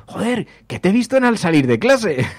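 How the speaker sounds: loud, high-pitched mock exclamation; exaggerated excited recognition; excited caller. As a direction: imitate an amazed fan blurting it out. Imitates excited callers who saw them.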